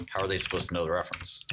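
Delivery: ordinary delivery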